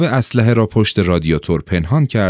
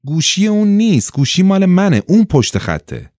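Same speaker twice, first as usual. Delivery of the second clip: high, exasperated correction; impatient, pitch jumping up then dropping at the end; 10 semitones higher than usual. A narrator's reading. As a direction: set them straight, out of patience.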